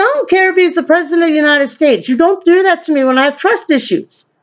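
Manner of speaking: high, fast, indignant comeback; acted-out indignant telling-off; indignant retort. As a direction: argue back heatedly, pitch up. Retold quarrel with Amber.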